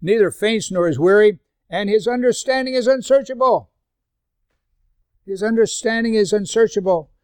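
loud, high scripture reading; preaching at full force. Acted. Pastor reading Isaiah to the congregation, loud and high. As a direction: proclaim the verse out.

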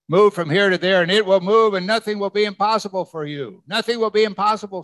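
high, emphatic scripture declamation; forceful proclamation. Acted. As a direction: proclaim it with rising preacher's fervor. Preacher declaims Jesus' promise of faith.

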